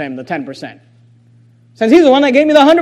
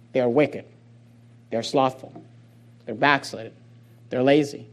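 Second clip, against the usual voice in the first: much lower, softer list; hushed emphasis; 10 semitones lower, 9 dB quieter than usual. Real, not acted. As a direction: drop to a grave hush.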